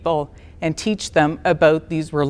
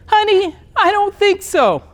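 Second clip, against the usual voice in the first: gentle counselor; 11 semitones higher than usual. Retold or imitated. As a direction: voice the kind counselor gently correcting her. Voices kind counselor gently disagreeing.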